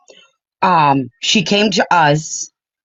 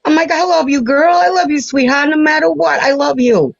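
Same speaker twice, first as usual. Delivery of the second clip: tender reassurance; 8 semitones higher than usual. Retold or imitated. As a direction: reassure her she is loved, lifted and gentle. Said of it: "And I was like".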